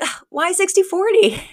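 high, put-out whiny protest; indignant whine. Retold or imitated. Friend retells Val haggling over prize money, indignant whine. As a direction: whine it like being cheated. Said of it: "she's like".

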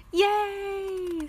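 high, bright yay; excited cheer. Acted. Piggy cheering at Gerald's offer, excited. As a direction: cheer like a happy little pig.

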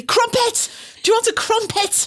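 high, bright-eyed announcement of the found word; pleased as punch, naming the crumpet; triumphant. Retold or imitated. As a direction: crow it like you've just won. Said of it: "said triumphantly".

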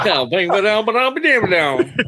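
high, loud twangy banjo noises; banjo imitation. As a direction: plunk it out like a banjo. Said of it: "I sound like a fucking banjo talking".

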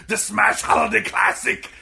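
hammed-up Austrian strongman shout; fake Arnold. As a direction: bellow it as a bad action-star impersonation. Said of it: "with the fake Arnold going".

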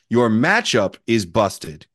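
ordinary talk

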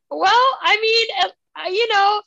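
flustered mom. Retold or imitated. Mom answers dad about her show, flustered and embarrassed. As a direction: imitate a mom caught out and stalling.

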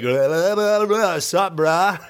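lazy surfer drawl put on; a lightly voiced surfer ghost; dudebro ghost voice. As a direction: babble like a cool bro ghost. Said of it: "And he's like".

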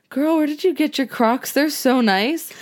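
high, dreamy compliment, voice lifted well up; drugged gushing compliment. Retold or imitated. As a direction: gush over the shoes like you're high on painkillers. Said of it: "and I'm like".

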